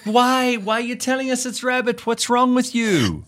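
high, incredulous mock outrage; Larry aghast at the girlfriend spoiling Wordle, mock outrage; exasperated Larry. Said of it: "He's like".

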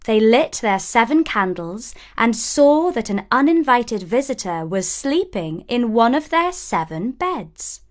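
ordinary delivery, nothing marked

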